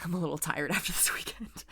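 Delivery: whispered through a yawn with a small laugh; barely voiced and drowsy, winding down; sleepy whisper. Real, not acted. Vlogger admits to her viewers she is worn out, sleepy whisper. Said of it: "I'm yawning".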